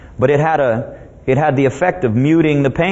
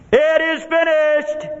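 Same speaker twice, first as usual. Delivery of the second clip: high, slow triumphant cry; loud cry; 13 semitones higher, slower than usual. Acted. Jesus on the cross as all is fulfilled, loud cry. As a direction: cry it out high, stretching each word. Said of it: "With a loud voice".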